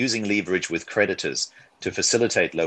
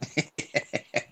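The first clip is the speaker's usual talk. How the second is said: light laugh; 8 semitones higher, 6 dB quieter than usual. Real, not acted. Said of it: "laughs at French sign-off".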